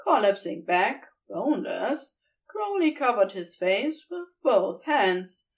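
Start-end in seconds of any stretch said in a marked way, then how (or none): none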